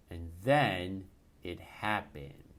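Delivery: plain reading voice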